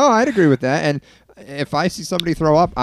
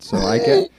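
retching sound; gagging retch on cue; vomit noise. Real, not acted. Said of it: "That is actually [name] making that noise".

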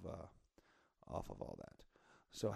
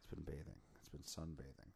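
whisper; 9 semitones lower, 16 dB quieter than usual. Real, not acted. Echoes wife's joke.

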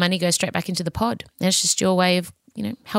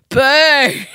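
playful booing, pitched up; higher mock boo; 8 semitones higher, 5 dB louder than usual. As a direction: boo again, higher and louder.

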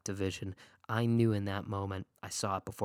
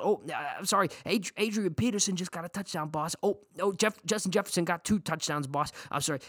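higher, fawning underling reporting to the boss; nervous henchman; 6 semitones higher than usual. Retold or imitated. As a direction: cringe up to the mafia boss with bad news. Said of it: "I was one of his little henchmen".

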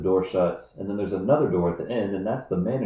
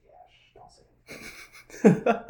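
slow, breath-only hush; conspiratorial whisper; slower than usual. Retold or imitated. Prankster to cousin at back door, secretive whisper. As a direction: shush them to protect the secret.

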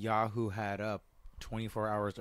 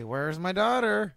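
higher, slow, anxious mock plea; worried mom; 8 semitones higher, 4 dB louder, slower than usual. Friend acts out the mother asking where her daughter is, mock worried. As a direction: play the frantic mother asking for her child. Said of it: "The mom was like".

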